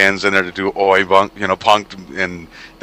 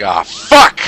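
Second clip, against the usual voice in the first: mock outburst; 7 dB louder than usual. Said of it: "He's like".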